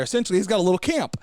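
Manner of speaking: ordinary talk, nothing marked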